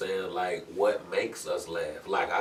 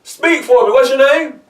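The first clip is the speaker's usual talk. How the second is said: very loud, high cop questioning; police officer; 19 dB louder than usual. Acted. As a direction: shout questions at an uncomprehending driver. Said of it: "when the police officer came".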